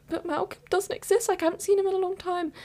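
high, hurried objection; imitated incredulous protest. Student objecting to friend's plan, high-pitched mock incredulity. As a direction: pitch it right up in retold disbelief.

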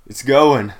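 a lightly voiced shrug of a reply; mock small talk. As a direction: toss it off like a polite customer. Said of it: "you still say something along the lines of".

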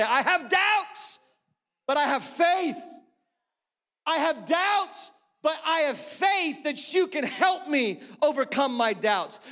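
high, slow pleading confession; pleading cry. Acted. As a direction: plead it like the father. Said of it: "cry out to Jesus to say".

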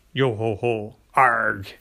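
lightly voiced pirate growl, low; mock pirate. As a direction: toss off a playful pirate arg.

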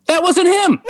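high-pitched, insistent acted correction; retold protest. Correcting his friend's years-long belief, acted insistent protest. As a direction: pitch it up, correcting her urgently.

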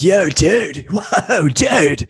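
high-pitched California surfer dude; amazed Californian dude caricature; California accent. Shown as a demonstration. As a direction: stretch it out in a mock California accent. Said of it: "what's a California accent?".

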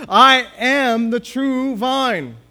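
"I am the true vine" loud, high and very slow; emphatic declaration. Shown as a demonstration. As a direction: proclaim each word of "I am the true vine". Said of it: "again, just emphasizing".

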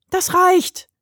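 hoch und laut gebrüllt; brüllend. A narrator's reading. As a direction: aus voller Kehle brüllen. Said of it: "brüllte die Flöte aus allen Rohren". (wütend brüllende Flöte)

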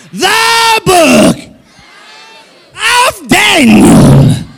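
hammed-up revival preacher announcing scripture; mock Pentecostal preaching to the pews. Shown as a demonstration. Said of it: "It's the Pentecostal preacher voice".

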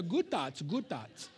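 lightly put-on mock accent on "Good thoughts", soft and trailing. Shown as a demonstration. Pastor mocking Eastern meditation with a little accent. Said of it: "Do you like my little accent there?".

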